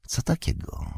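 breathless, greedy whisper; urgent whisper. A narrator's reading. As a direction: hiss it under the breath, hungry. Grishnak grips Pipin, whispers. (chciwy, pośpieszny szept)

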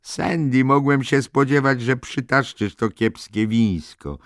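wysoki, podniesiony głos z przyganą; głośny wyrzut. Acted. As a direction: wytknij jej to głośno i wysoko. Mock reproach to Sandy. (głośna przygana, przeciągnięta na końcu)

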